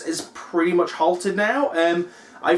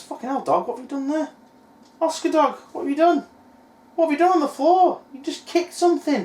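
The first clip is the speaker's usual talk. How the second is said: startled scolding of the dog; 8 semitones higher than usual. Real, not acted.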